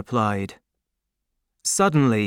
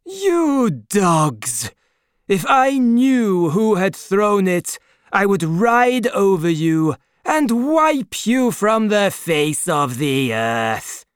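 higher, louder, menacing aristocrat; furious Marquis; 7 semitones higher, 5 dB louder, slower than usual. A narrator's reading. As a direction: spit out the threat at the peasants. Marquis threatens crowd.

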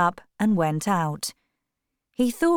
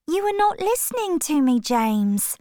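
put-on much higher, fast girlish complaint; playful reproach; 9 semitones higher than usual. A narrator's reading. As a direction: tease him for not listening. Sister reproaches brother.

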